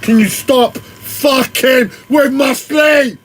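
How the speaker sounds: loud, high, drawn-out outburst; real, high-pitched furious yell; angry shout. Real, not acted. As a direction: yell it slowly in exasperation. Exploding at whoever disturbs sleep.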